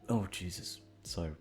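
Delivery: ordinary delivery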